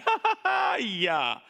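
high mocking laugh dropping into a dry yeah; sarcastic laugh. Shown as a demonstration. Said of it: "this is very sarcastic".

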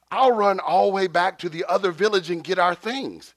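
high, loud, hurried offer; eager teenager. Retold or imitated. Teenager volunteering to escape the job, eager high outburst. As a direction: imitate a teen desperate to get away. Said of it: "the teenager suddenly says".

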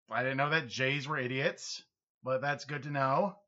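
usual speaking voice

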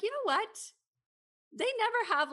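sudden realization. Retold or imitated. Realization, high voice.

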